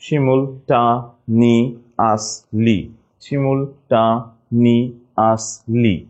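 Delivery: very slow "শিমুলটানিয়াসলি", syllables apart; spelling pronunciation. Shown as a demonstration. Teacher giving spelling, "শিমুলটানিয়াসলি" slowly in pieces. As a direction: break "শিমুলটানিয়াসলি" into shimul, ta, ni, as, li. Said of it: "বানানটা হচ্ছে".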